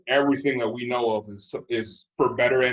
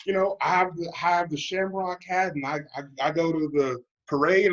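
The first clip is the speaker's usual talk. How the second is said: high, whiny mock-defensive voice; a lightly hammed-up plastic paddy protesting; mock proud Irish American; 7 semitones higher than usual. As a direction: play the proud hyphenated American whining his case. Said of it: "They're like".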